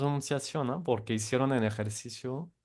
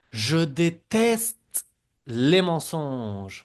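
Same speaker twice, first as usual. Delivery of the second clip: déteste stretched out slow and louder; slow emphatic model; 3 semitones higher, 6 dB louder, slower than usual. Shown as a demonstration. Teacher models the sentence loudly and slowly for students. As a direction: hammer out Je déteste les mensonges slowly. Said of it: "repiten las frases también".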